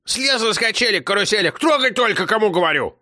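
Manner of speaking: put-on high, bossy old woman; grandma commanding the car to move. A narrator's reading. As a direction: order the driver to go.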